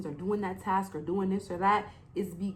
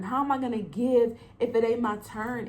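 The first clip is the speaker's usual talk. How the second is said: normal talking voice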